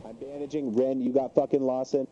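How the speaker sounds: normal talking voice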